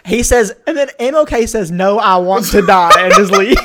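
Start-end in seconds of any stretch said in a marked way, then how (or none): 1.7-3.6 laughing. Retold or imitated. Retelling cartoon scene.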